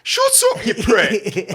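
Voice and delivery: high, loud comeback shout; retorting yell. Retold or imitated. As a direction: yell an insult back at a driver. Acts own retort to the driver.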